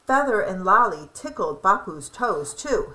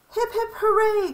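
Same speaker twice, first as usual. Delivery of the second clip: high, joyful cheer; 12 semitones higher than usual. A narrator's reading. The birds cheer Lolly's feather back, high. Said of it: "cried the birds".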